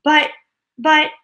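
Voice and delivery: high, loud stammering; panicked shout. A narrator's reading. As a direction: stutter it out in fright. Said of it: "shouted Grandpa Joe".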